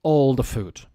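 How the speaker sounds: "literally" and "food" pushed loud; emphatic exaggeration. Shown as a demonstration. Teacher showing how to exaggerate a shared meal, loud. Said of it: "you could say".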